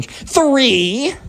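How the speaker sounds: high, long drawn-out count; spoiled kid ultimatum. Acted. Spoiled kid finishes his countdown at Caroline, bossy ultimatum. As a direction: stretch the final count high, bratty.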